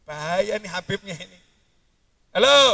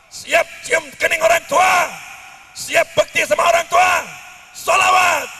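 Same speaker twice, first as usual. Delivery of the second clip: loud, higher rally-style calls; Habib Rizik shout; 7 semitones higher, 5 dB louder than usual. Retold or imitated. Preacher keeps imitating Habib Rizik's loud crowd calls. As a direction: rouse the crowd with each call. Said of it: "Saya tak tiru-tiru Habib Rizik".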